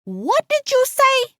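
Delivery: high, slow demand to hear it; drawn-out incredulous question; indignant shock. A narrator's reading. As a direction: challenge her in high-pitched disbelief. Alice shocked, face turned sour.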